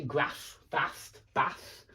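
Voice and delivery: northern English short a in "grass, fast, bath"; northern UK pronunciation. Shown as a demonstration. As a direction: separate northern-accented words. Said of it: "in the north of the U K".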